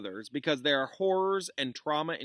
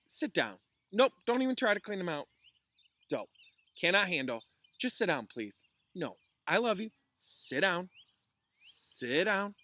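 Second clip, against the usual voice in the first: firm command; 8 semitones higher, 4 dB louder than usual. Real, not acted. Teacher orders student to sit.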